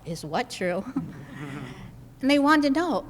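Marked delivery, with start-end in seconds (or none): 0.1-0.8 softer, lower inner voice, to herself; a quiet puzzled thought to herself. Retold or imitated. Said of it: "I'm thinking to myself". 0.9-1.9 a quick breathy laugh, much softer; short soft chuckle. Real, not acted. Laughing at the confusion.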